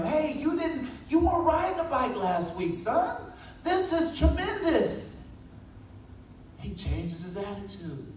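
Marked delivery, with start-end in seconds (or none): 0.0-5.3 high, bright-pitched praise for his son; proud encouragement. Retold or imitated. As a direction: lift him up with pride. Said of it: "as I'm saying these things to him".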